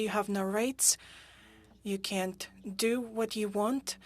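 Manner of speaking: usual speaking voice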